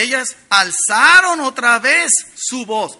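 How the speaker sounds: a slow, loud anguished outcry read from the verse; loud cry. Shown as a demonstration. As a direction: lift the voice as if crying out in agony. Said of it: "Alzar la voz es como gritar en agonía". (alzando la voz, lento y fuerte, como un grito de angustia)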